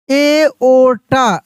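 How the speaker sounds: एओर्टा drawn out very slowly, syllable by syllable; slow model word. Shown as a demonstration. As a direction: stretch एओर्टा out for learners. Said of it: "ऐसे एओर्टा".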